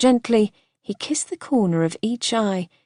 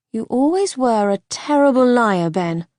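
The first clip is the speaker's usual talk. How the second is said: higher, louder, affectionate jab; fond teasing; 5 semitones higher, 5 dB louder than usual. Acted. Wife calling her husband a liar, fondly teasing. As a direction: catch him out in a loving way.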